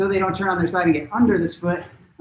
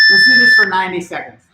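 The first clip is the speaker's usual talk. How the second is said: calling out; 10 dB louder than usual. Real, not acted. Coach calling start of drill.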